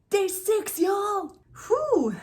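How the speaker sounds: high and loud, celebrating day six; triumphant whoop at the finish; cheering to viewers; excited cheer. Real, not acted.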